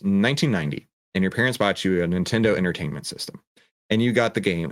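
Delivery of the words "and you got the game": ordinary talk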